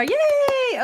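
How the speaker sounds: high-pitched drawn-out yay; excited cheer. Real, not acted. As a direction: cheer as your audience finally shows up. Viewers finally join the stream.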